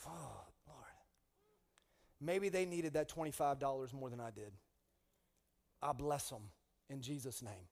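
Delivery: softly blessing them in prayer; quiet prayer. Retold or imitated. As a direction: surrender it gently to God. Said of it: "I just said".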